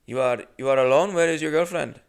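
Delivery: put-on pry in a light Indian accent; Indian accent impression. Clerk asking the comic about a girlfriend, light Indian accent. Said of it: "unfortunately, keep doing it".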